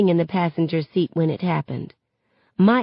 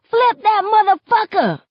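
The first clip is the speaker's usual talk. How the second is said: loud, high rallying yell; 5 dB louder than usual. A narrator's reading. Somebody in the mob at a police car, yelling. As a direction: yell it out to a riotous crowd. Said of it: "Then somebody yells".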